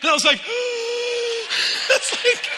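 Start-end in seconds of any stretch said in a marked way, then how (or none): 0.4-1.8 high, shocked gasp; sharp gasp. Retold or imitated. Exasperated at being asked restart.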